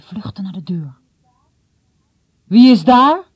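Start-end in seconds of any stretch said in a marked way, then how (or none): 2.4-3.3 loud, high challenge; father calling out. A narrator's reading. Father hears someone in the house, calling out loudly. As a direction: call out sharply to an unseen intruder. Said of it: "hoorde hij. Dat was vaders stem".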